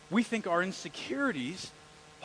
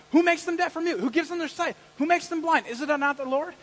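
loud, high exasperated scolding; shouting God, exasperated; 11 semitones higher, 11 dB louder than usual. Retold or imitated. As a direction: yell at Moses like a fed-up boss. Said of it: "He's basically saying".

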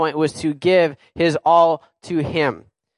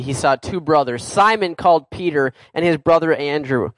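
ordinary talk

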